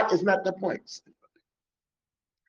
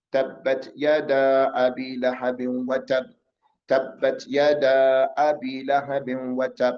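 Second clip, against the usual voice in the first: measured recitation; 4 semitones lower than usual. Shown as a demonstration. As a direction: recite it evenly, drawing out each long vowel. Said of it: "read it like it's, you're reciting".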